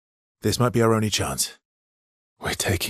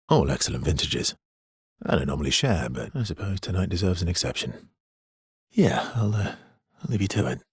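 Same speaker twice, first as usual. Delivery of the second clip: usual speaking voice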